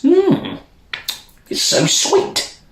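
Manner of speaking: high sweet hum then slow breathy words; a light touch of sleepy courtiers. Acted. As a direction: savour it, slowing down. Courtiers tasting the syrup.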